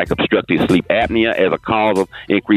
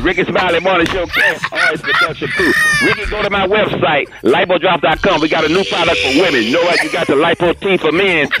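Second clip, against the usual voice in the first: ad read; 8 semitones higher than usual. Acted. High ad read for product.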